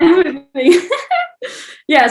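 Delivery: laughing out the word, pitch jumping. Real, not acted. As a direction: echo it through a burst of laughter. Echoes question through laughter.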